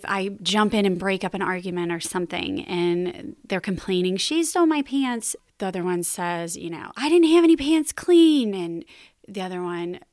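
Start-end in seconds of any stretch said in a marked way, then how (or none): 4.1-5.3 put-on higher, whining kid complaining; whiny child. Kids quarrel voiced by mom. 7.0-8.5 put-on much higher, louder protesting kid; indignant child. Other kid defends herself.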